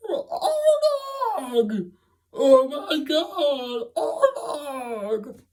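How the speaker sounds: very high, stretched-out goofy cries of surprise; dopey Freddy voice. Retold or imitated. Imitating Freddy spotting the narrator, high goofy squeal. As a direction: squeal it like a wide-eyed dimwit. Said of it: "he goes".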